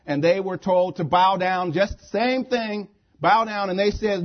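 loud, high-pitched sermon delivery; impassioned preaching. Acted. Preacher tells the congregation the Bible story, impassioned.

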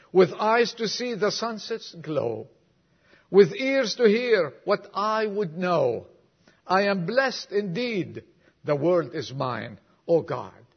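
preacher reciting a moving poem to the congregation; poem recital. Acted. Reads a whole poem aloud.

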